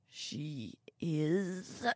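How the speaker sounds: put-on old man choking on his words, soft; quiet strangled start of a sentence; choked. A narrator's reading. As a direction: strain it out and break off. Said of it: "the old man choked".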